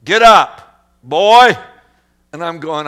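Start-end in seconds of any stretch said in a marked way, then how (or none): 0.0-1.5 loud mock yell at the boy; dad yelling. Father yelling across the marsh at his fallen son. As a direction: holler at him to get up. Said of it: "He's yelling at me".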